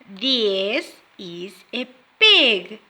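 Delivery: slow, careful, each word clear; slow teacher modelling. Shown as a demonstration. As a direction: spell it out slowly for young learners. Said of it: "listen and repeat".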